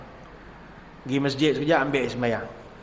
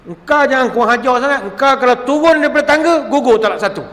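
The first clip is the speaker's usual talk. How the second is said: fast, loud and high, snapping at the wife; husband shouting a divorce threat at his wife; angry threat; 8 semitones higher, 6 dB louder, faster than usual. Retold or imitated. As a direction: yell the ultimatum up the ladder. Husband threatens wife mid-quarrel.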